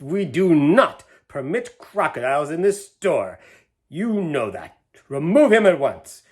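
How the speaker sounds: a cross manager laying down the rule; mild anger. A narrator's reading. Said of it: "purple with rage".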